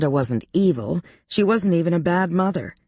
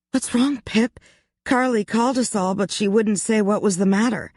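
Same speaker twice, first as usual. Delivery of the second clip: high worried friend voice; 7 semitones higher than usual. Acted. Ashley's worried question to friend.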